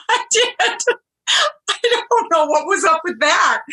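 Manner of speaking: high, giggly admission; laughing it off. Real, not acted. Laughing at herself over the snails, giggling. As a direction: laugh through the confession.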